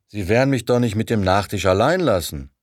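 loud, aggressive challenge. A narrator's reading. Burr presses Jonathan over dessert, aggressive and loud. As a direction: push him hard with the question. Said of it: "fragte Burr aggressiv".

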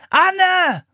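high, loud yell; shouted name. A narrator's reading. Philipp calling his fleeing sister back, shouting. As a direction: scream the name after her. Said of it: "schrie Philipp ihr hinterher".

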